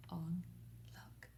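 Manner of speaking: soft whisper. Acted. Teacher to nursery, whispering so friends sleep. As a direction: hush so they sleep.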